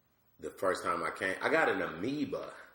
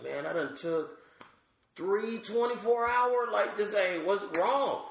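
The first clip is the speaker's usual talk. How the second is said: exasperated outburst; 13 semitones higher, 4 dB louder than usual. Retold or imitated. Sick, frustrated medicine not working.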